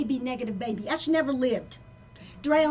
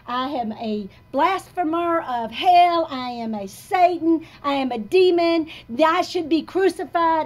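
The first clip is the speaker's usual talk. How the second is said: retold self-condemnation; 5 semitones higher, 7 dB louder than usual. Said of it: "I have people said".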